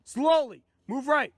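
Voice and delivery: loud, firm police orders; shouting commands. Acted. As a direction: bark orders at the suspect. Officer shouting commands at suspect.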